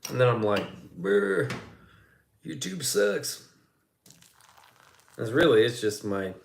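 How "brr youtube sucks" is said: mock whining. Imitates self whining after collapsing.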